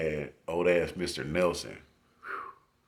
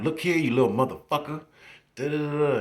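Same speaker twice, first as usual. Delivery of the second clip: high, louder mock cussing out; 9 semitones higher, 6 dB louder than usual. Teacher cussing out a misbehaving student, high mock scold. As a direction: scold like a fiery little lady. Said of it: "Then she'll cuss you out".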